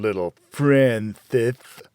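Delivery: slow, sneering taunt of a name; lightly voiced cruel father mocking his son. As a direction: drag out the name to mock him. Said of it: "He mocked my name".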